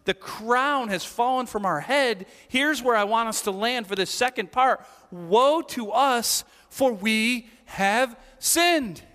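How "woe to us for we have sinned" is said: woe drawn out, very slow; slow lament. Shown as a demonstration. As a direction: savour each word like a confession. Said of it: "we don't use the word woe".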